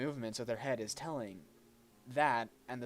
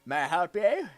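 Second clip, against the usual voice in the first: loud, high mock Australian; Australian accent; 5 semitones higher, 13 dB louder than usual. Shown as a demonstration. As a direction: play her as a hollering Australian. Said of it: "She's Australian".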